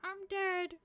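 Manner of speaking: high put-on granny voice; high-pitched mock deathbed line; dying old lady. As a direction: announce death as a comic old woman.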